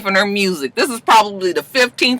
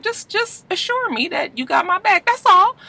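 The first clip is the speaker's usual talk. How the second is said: high, pleading quote; mock plea; 8 semitones higher, 5 dB quieter than usual. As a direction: imitate her asking for reassurance in a high voice. Acts out her needy plea.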